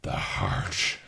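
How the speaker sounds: quietly menacing, drawn out, with a soft T; menacing Moriarty. Acted. Fan quoting Andrew Scott's Sherlock villain, slow quiet menace. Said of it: "so menacing".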